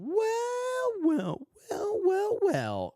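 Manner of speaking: squeaky high-pitched mimicry; mocking high voice. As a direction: parrot her shrill well well. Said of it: "man, her voice".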